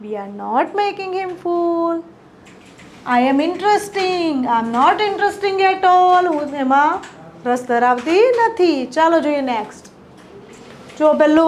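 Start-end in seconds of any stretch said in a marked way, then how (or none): 0.0-6.3 very slow, clear dictation of each English word; teacher modelling English slowly; slow model sentences. Shown as a demonstration. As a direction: spell the sentence out slowly for learners. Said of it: "આનું positive શું થતું હતું?".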